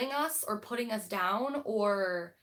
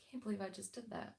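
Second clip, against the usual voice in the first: much softer, under the breath; muttered disbelief; 11 dB quieter than usual. Real, not acted. Vlogger muttering to herself after her slip, soft disbelief. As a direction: mumble it to yourself in disbelief. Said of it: "muttering at her own slip".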